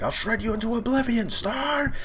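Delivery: high-pitched mock villain cry; hammed-up battle threat. Acting Gia's attack threat.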